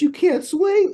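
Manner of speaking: very high, loud incredulous question; retold shocked, amused outburst; amused disbelief. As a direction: squeal it back in mock astonishment. He thought that was the funniest.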